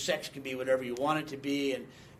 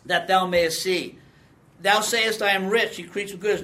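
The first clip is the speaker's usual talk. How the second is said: louder, solemn preaching read of the verse; scripture reading; 5 dB louder than usual. Acted. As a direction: proclaim it from the pulpit. Reads Revelation passage.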